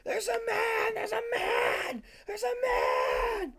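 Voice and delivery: very high, drawn-out mock toddler wail; screaming and wailing. Retelling himself at three crying for mom, high screamed wail. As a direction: wail it as a terrified three-year-old, stretched out. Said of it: "screaming and wailing".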